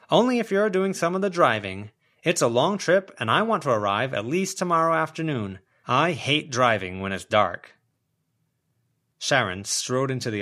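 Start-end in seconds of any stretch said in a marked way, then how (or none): 0.1-7.8 pushy neighbour voice. Acted. Sharon voiced, higher and louder.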